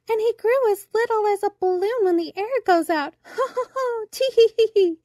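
very high tittering voice breaking into laughter; giggling daisies. A narrator's reading. Daisies who enjoy a joke mock the boy, high giggling. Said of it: "they giggled".

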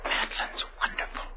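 hushed, breathy private musing under the scene; whispered inner thoughts. Acted. Gordon privately musing about his mother, in a hushed whisper. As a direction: let the thoughts slip out in a whisper, as if no one can hear.

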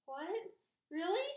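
high, startled, hopeful questions; cried out. A narrator's reading. Pig hearing he shall not die, cried out. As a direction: cry it out in sudden amazement. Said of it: "cried Wilbur".

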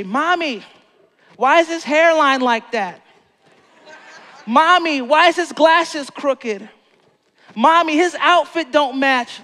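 put-on high, loud whining little-kid voice. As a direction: mimic a nosy kid calling out to Mommy. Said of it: "those little kids when you're at the store".

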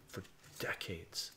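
a breathy echo of the last words; muttered under breath. Real, not acted. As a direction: say it again almost to yourself.

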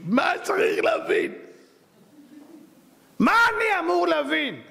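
scoffing slow question; mock bewilderment. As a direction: drag it out like you can't believe it. Mocking the art lovers' demand.

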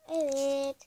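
sudden loud sneeze; sneezing. Real, not acted. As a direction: let out a sharp sneeze. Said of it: "Hapşurum geldi". (hapşırma)

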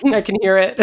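high laughing through words. Real, not acted. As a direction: laugh while saying it. Laughs at crying friend.

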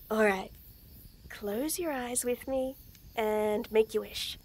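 usual speaking voice

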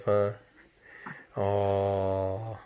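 normal talking voice

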